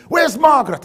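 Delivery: high, demanding shout of an angry boss; screaming boss. Retold or imitated. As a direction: imitate a boss yelling for the secretary. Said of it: "he starts screaming at me".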